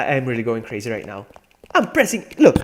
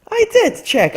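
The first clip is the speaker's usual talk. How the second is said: exasperated shout; 9 dB louder, slower than usual. Real, not acted. Frustrated at the game.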